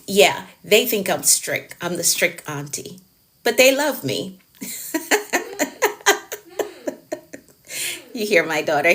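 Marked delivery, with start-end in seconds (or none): 4.6-6.4 high giggling laugh; a run of high, airy chuckles. Real, not acted. Laughing after strict auntie remark.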